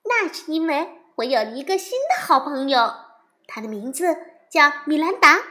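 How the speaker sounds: put-on eager young bear telling about her new friend; little girl voice. Sister Bear's voice. (小女孩的声音，又高又快)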